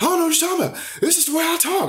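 very high mocking imitation of her; high-road retort, imitated. Imitating a woman denying she talks differently, high and indignant. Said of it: "tried to high road".

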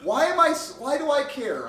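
high-pitched, exasperated self-questioning; retold upset. Man alone in his car after an argument, upset. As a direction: wail it lightly at yourself. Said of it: "And I was so upset".

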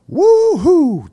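high, loud whooping cheer; woo-hoo button. Shown as a demonstration. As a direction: whoop it like a sound-effect button. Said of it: "I gotta get that woo-hoo button".

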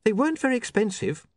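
put-on high-pitched, mildly anxious young woman; understated nerves in a high voice; nervous. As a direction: explain it high, a touch unsure. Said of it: "said Saccharissa nervously".

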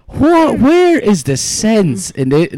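high-pitched baffled outburst; mock-outraged bewilderment, drawn out; incredulous. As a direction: demand it in exaggerated disbelief. Retelling own baffled reaction to name.

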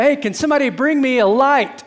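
mild shout for help; calling out. Retold or imitated. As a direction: call out to someone in another room. Calling out to family for light.

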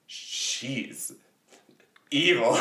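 hushed then sharp accusing; Grumpy's suspicious voice. Retold or imitated. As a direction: whisper it like a secret, then snap. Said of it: "he's like".

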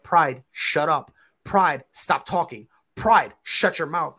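slow, deliberate self-reprimand; inner scolding. Retold or imitated. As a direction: tell yourself off with spaced-out commands. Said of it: "subconsciously, I'm like".

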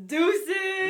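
loud, high sung-out goodbye; mock quick farewell. Spotting a clown in here and bailing, loud comic send-off. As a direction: call it out as you flee. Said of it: "I'd be like".

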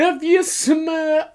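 very high, drawn-out wrestler catchphrase; hammed-up high catchphrase imitation; catchphrase wind-up. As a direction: stretch it out high like a showman. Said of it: "he says it all the way up".

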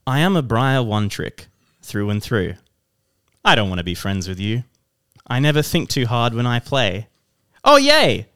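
hammed-up, loud Doctor Seuss-style reading; thespian poem recital. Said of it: "bring a bit of thespian candor".

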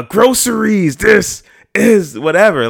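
battle cry in a grocery store; mock Leonidas bellow. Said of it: "if you at the store".

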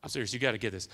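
ordinary delivery

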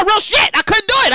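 ordinary delivery

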